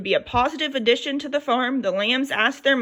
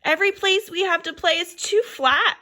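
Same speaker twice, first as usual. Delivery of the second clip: higher lamb voice, complaining; whiny lamb; 7 semitones higher than usual. A narrator's reading. First lamb complaining to the others, higher lamb voice. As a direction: whine it like a bored little lamb. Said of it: "the first lamb said".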